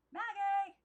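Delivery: high, loud call from the garden. Acted. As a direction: call her name across the yard. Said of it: "It was Aunt Lillian".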